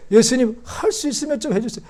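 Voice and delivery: high, tearful-sounding pleading to Jesus, mocked; mock whiny plea. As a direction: whimper the request like a hesitant believer. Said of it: "예수님에게".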